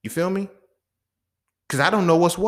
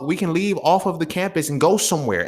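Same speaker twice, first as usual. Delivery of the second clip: normal talking voice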